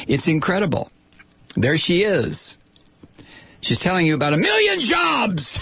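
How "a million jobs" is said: high mock-salesy shout; shouted in exaggerated hype. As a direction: belt it out, mocking the pitch. Mocking the ad spokeswoman's pitch.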